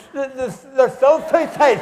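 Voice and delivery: high, loud, slow voice of a man pinched by tight shoes; squeezed complaint. Acted. Customer tells the shopkeeper in a high, loud, slow squeezed whine. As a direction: wail it out like the shoes are crushing his feet.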